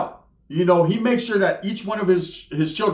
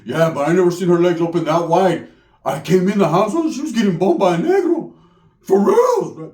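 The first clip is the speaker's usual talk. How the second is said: indignant cousin; 4 dB louder than usual. Retold or imitated. Cousin telling cheating story.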